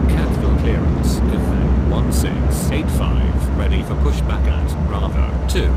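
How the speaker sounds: loud crackly radio transmission; harsh clipped radio squawk; radio static call. Acted.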